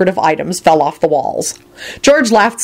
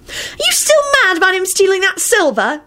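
disgusted yell; 14 semitones higher than usual. A narrator's reading. Ginny scolding over stolen silver, disgusted yell. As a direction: shriek it in high-pitched disgust. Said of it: "Ginny yelled out in a disgusted voice".

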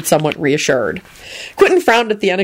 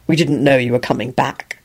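lower, even and careful; measured tone; 5 semitones lower than usual. A narrator's reading. Quentin to returning Loretta, lower measured tone. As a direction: keep it level and controlled. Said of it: "in a measured tone".

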